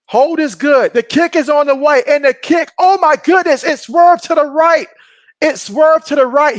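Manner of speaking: high, loud live call of the missed kick, slowing down at the end; excited play-by-play. Real, not acted. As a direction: call the miss like a stunned commentator. Missed field goal live call.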